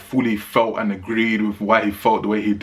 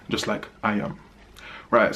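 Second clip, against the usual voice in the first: normal talking voice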